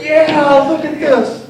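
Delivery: high, loud amazement; excited. Retold or imitated. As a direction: gush at the mansion. Amazed at the mansion.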